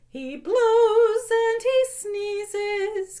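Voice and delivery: put-on lilting sing-song read to kids; sing-song picture book reading; storybook read-aloud. A narrator's reading. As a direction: read it like bedtime story time, half singing.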